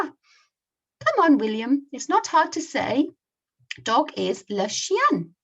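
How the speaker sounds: high, loud, coaxing teacher voice; French teacher. Acted. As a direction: coach the pupil, slowing on the French.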